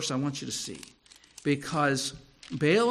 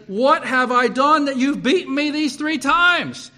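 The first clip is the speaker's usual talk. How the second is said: loud, high, drawn-out complaint; protesting donkey; 8 semitones higher, 8 dB louder than usual. Acted. Beaten donkey confronts its master Balaam, loud aggrieved protest. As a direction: protest loudly and slowly, as the wronged animal.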